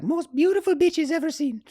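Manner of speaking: very high, loud mock Italian accent; Simone Moro. Italian climber in the tent inviting Corey to Italy, high imitated voice. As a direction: play an Italian climber gushing in a high caricature. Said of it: "And he's like".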